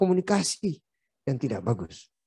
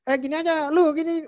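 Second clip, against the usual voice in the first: much higher, brash and pushy; rude pointing manner. Retold or imitated. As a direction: boss someone about without manners. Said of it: "jangan sampai begini tangannya". (nada kasar dan tinggi sambil menunjuk-nunjuk)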